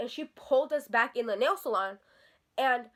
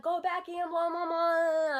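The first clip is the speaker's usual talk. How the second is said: higher, drawn-out gibberish imitation; mocking mimicry; 6 semitones higher, slower than usual. Teller mimics the salon worker she couldn't understand, mocking mimicry. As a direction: mimic her in a high, slurred babble.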